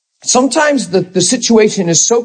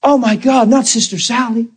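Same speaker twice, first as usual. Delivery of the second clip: high, stunned disbelief; mock dismay at a friend's cancer; shocked dismay; 7 semitones higher than usual. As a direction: gasp it out like bad news just hit. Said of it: "And you go".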